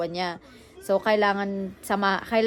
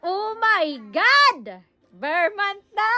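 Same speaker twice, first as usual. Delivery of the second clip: much higher, louder squealing cheer; ecstatic; 12 semitones higher, 6 dB louder, slower than usual. Real, not acted. Mom squealing to viewers that the ber months are here. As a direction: shriek it with pure glee. Excited about September coming.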